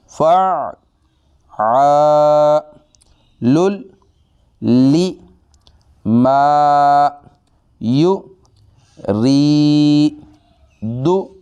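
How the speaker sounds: फ़ा आ लुल ली मा यू री दु, each sound said apart and high; syllable by syllable. Shown as a demonstration. Teacher sounding out each syllable for students. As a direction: break it into separate syllables. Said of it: "तोड़ करके पढ़ रहा हूँ".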